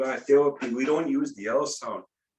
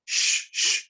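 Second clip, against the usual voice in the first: ice moving shh. Retold or imitated. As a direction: breathe out shh, shh like lake ice shifting. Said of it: "it makes that sound".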